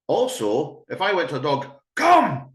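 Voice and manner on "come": high, loud, hard come; harsh shout. Shown as a demonstration. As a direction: yell it so it is not inviting. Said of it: "is that inviting".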